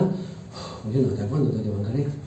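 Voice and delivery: low, hushed, weary sigh to God; acted long-suffering spouse under their breath; exasperated sigh. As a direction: sigh heavily, then grumble it quick and soft. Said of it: "pero hay suspiros que son".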